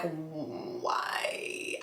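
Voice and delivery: very soft, low, drawn-out why; hushed, stretched-out dismay; pained disbelief. Acted. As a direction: let it sink into a quiet, pained whine. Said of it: "and I'm like".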